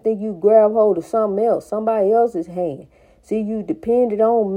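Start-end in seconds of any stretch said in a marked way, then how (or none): none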